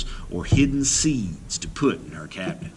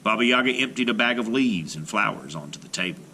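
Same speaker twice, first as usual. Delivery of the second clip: ordinary delivery, nothing marked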